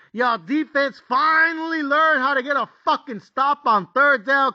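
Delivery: high, slow, stretched-out protest; exasperated pleading. Retold or imitated. Bettor pleading with his team as the lead shrinks, exasperated.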